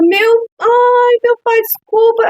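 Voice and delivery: put-on high, loud apologetic child voice; frightened child. Child begging parent's pardon after breaking a plate, high pleading. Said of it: "A criança".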